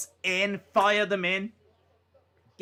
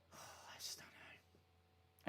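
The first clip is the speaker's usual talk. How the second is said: slow unvoiced resigned breath; sighing whisper; 32 dB quieter, much slower than usual. Real, not acted. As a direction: let it fade out on a sigh. Sigh of resignation.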